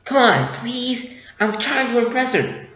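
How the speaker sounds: mock whiny plea; pleading. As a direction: beg the droid like a desperate friend. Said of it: "so he's like".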